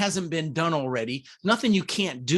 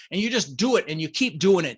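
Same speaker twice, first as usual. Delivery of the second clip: normal talking voice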